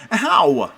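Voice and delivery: loud, high yelp of pain; pained scream. A narrator's reading. Boy bitten on the arm, pained scream. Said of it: "I screamed".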